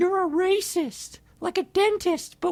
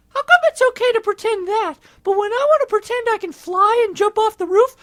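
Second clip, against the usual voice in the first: whiny; 5 semitones higher, 8 dB louder than usual. Acted. Whiny complaint to Space Chicken.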